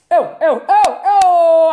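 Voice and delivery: laughing, loud cries of oh; overwhelmed oh. Shown as a demonstration. Retelling how she reacted before going live, laughing oh cries. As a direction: let the oh's spill out with a laugh. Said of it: "I was doing like this".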